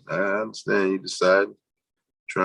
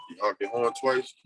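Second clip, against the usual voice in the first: ordinary talk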